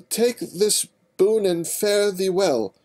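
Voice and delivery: high, loud, slow regal farewell; royal voice. Acted. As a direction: finish grandly despite the stumble. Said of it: "her best rendition of a royal voice".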